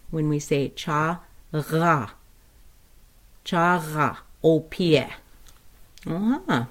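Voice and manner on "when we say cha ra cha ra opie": "cha ra" split, throaty r; guttural sound. Shown as a demonstration. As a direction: break "cha ra" to show the guttural. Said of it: "we have a guttural".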